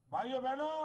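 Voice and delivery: loud, high rally speech to the crowd; rally oratory. Real, not acted. Politician rousing a rally crowd, loud and high. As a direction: preach it at full force to the crowd.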